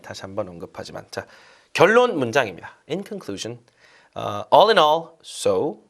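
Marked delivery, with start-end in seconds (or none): none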